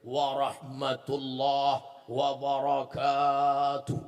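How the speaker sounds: slow, drawn-out, low sung salam like a stiff formal preacher; formal preacher greeting. Retold or imitated. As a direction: chant the greeting slowly and grandly, mocking formal style. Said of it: "dari mulai salam". (salam lambat dan dilagukan seperti penceramah formal)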